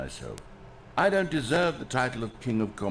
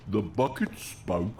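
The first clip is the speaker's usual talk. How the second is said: slower, lower bucket voice attempt; 5 semitones lower, slower than usual. Shown as a demonstration. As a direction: another try at the bucket voice. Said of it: "I'll figure it out on the fly".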